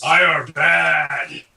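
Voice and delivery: drawn-out monster voice; Frankenstein. Acted. Player redoes the Hotel Transylvania quote, monster voice. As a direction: stretch both words like a lumbering movie monster. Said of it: "Frankenstein said in, uh, Hotel Transylvania".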